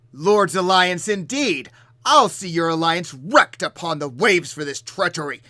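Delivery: loud, slow, booming storm giant roar; booming giant shout. Acted. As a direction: thunder it out like an angry giant. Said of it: "in this loud, booming storm giant voice".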